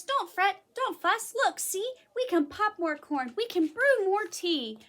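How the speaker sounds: high, eager little mouse voice; squeaky mouse. A narrator's reading. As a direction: squeak it out to soothe the bear. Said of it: "Mouse squeaks".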